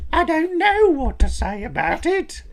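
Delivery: high-pitched Southern man. Retold or imitated. Describing high-pitched Southern men, high Southern accent. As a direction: squeak it out like those Southern men. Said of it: "heard that high-pitched".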